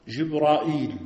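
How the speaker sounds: جبرائيل said Jibra-il, with long a and glottal stop; variant reading. Shown as a demonstration. Teacher shows students another reading, جبرائيل pronounced Jibra-il. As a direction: draw out جبرائيل as Jib-raa-eel. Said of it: "ومنهم من قرأ".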